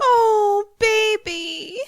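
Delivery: high, drawn-out coo; tender cooing. Acted. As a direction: coo it, moved by the reunion. Listener moved by reunion.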